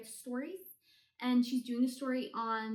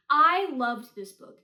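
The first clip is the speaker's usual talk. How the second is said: loud, slow, gushing declaration; LOVED it, drawn out; 12 dB louder, slower than usual. Real, not acted. Reviewer gushing to viewers about a favourite, loud and slow.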